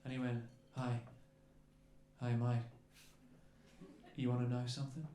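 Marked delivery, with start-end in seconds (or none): 0.8-2.6 low, understated Australian greeting; real casual Australian. Retold or imitated. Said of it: "real casual, just looking at us". 4.2-5.1 low, deadpan Australian murmur; real casual Australian. Retold or imitated. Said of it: "real casual, just looking at us".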